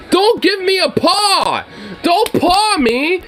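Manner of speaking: high-pitched, fed-up pleading; exasperated scolding. Real, not acted. Owner ordering his gentle dog to bite him, exasperated scolding.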